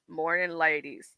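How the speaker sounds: low, slow attempt at a man's voice. Doing a man's voice for the ladies, low and slow. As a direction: greet the ladies like a deep-voiced man. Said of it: "I can't do a man voice".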